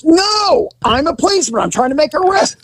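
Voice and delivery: high, exasperated insistence; high frustrated cop on the phone; exasperated insisting. Retold or imitated. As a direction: push it, nobody's listening. Partner insisting to dispatcher.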